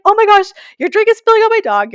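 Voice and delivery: high, loud alarmed cry; panicked shout. Retold or imitated. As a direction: yell to stop the spill on your dog. Drink spilling onto her dog.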